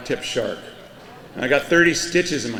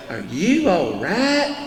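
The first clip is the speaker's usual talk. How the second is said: slow, higher, imitating a Florida counter clerk's accent; 4 semitones higher, slower than usual. Clerk behind the counter asking the zapped surfer, slow Florida accent. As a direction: drawl it out like the local clerk, concerned. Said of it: "'cause the accent plays into the whole thing".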